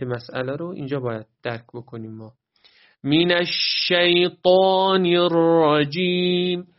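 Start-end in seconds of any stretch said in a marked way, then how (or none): 3.0-6.7 slow Quran recitation, higher and drawn out; measured recitation. Shown as a demonstration. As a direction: recite من الشیطان الرجیم with long held vowels. Teacher demonstrates Quran recitation. (تلاوت کشیده و شمرده)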